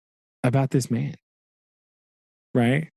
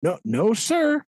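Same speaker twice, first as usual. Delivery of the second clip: meek sailor voice; 5 semitones higher than usual. Retold or imitated. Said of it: "He's like".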